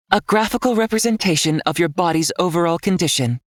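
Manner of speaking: an all-knowing guide speaking inside his head; calm system voice. A narrator's reading. System voice answers Nick.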